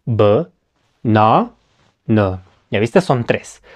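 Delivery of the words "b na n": "banana" broken into three separate low syllables with pauses; "banana" in three spaced-out beats. Shown as a demonstration. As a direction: say "banana" as buh, NA, nuh, one at a time. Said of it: "Esta palabra tiene tres partes".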